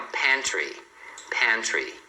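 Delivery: pantry with a clear T before R; T pronounced. Shown as a demonstration. Teacher models another word, the T in pantry pronounced. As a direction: keep the T sound in pantry. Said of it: "Aqui também".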